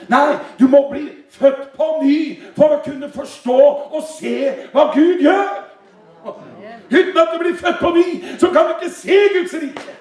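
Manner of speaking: high, impassioned sermon declamation; rising preacher's fervor; emphatic preaching. Real, not acted. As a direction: preach it insistently, pitch climbing.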